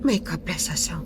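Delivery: hushed prayer for the dead; whispered prayer. Acted. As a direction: murmur a blessing.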